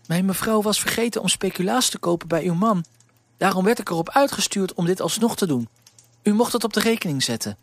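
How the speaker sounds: put-on dutiful errand girl explaining; young girl Elise.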